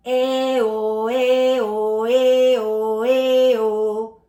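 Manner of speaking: loud "Eh, oh" switching bright to round; vocal warm-up vowel drill, loud; alternating eh and oh. Shown as a demonstration. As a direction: swing the palate up and down on each vowel. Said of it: "Watch, try this".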